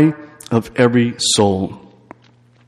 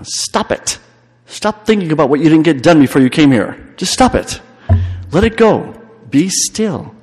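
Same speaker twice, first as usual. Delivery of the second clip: rising, louder urging to stop and let go; urgent command; 5 semitones higher, 4 dB louder than usual. Real, not acted. Preacher urging retreatants to drop their worries, rising urgent commands. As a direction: push them hard to stop, then let go.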